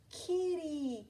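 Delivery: put-on very high, delighted childlike call; little girl greeting the big furry monster; little girl's voice. A narrator's reading. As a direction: coo the word like a happy toddler. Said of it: "she said".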